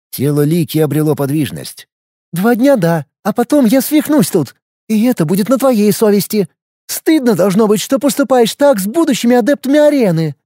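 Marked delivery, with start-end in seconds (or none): 2.3-10.3 put-on crazy girl voice. Crazy girl accusing her friend.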